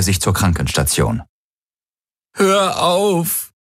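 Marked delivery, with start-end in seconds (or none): 2.3-3.5 very high, whimpering plea. A narrator's reading. Beaten man whimpers at the Helix, pleading. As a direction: whimper it out in pain. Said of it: "wimmerte er".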